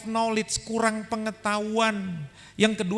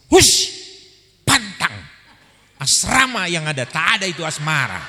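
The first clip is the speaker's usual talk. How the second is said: a sharp loud hush, then scolding; shocked mother shushing; 3 semitones lower, 6 dB louder than usual. Retold or imitated. Mother silencing her child's question, a loud sharp scold. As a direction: slam the topic shut as a flustered mother.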